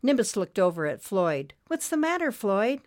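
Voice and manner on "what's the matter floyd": high, gentle small-creature voice; light and worried; high little spirit voice. Acted. Nimbus voiced in story.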